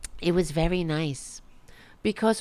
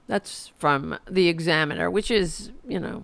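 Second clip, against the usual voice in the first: usual speaking voice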